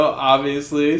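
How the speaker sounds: normal talking voice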